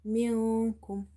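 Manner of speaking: slow منكم with the n held as a nasal hum; ikhfa. Shown as a demonstration. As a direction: hide and hold the noon in منكم. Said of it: "Here is ikhfa".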